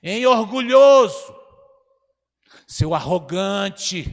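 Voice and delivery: loud, slow and high accusing cry; shouted insult. Retold or imitated. Church lady yelling at her pastor from the corner, loud and slow. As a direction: call him out from across the church. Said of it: "ela gritou do canto assim".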